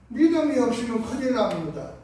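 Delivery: ordinary delivery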